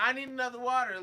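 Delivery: high, loud order through laughter; giggling while playing the bossy diner; laughing mock demand. Imitating rude customer, laughing.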